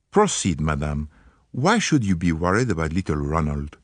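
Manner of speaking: lightly voiced Poirot, gallant and polite; a hint of a French-accented detective, charming; courteous Belgian detective. Poirot questions the visitor.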